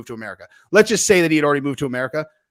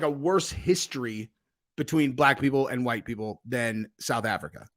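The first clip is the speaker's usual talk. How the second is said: usual speaking voice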